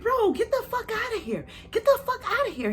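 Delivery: very high exasperated yelling; brother. Retold or imitated. Brother telling her to get out, high exasperated. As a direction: chase her off in disbelief. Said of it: "He was like".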